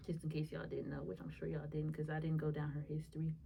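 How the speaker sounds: soft hushed aside to the viewers; whispered aside. Real, not acted. Whispering an aside to the viewers. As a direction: let it drop to a whisper. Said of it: "aside to viewers, whispered".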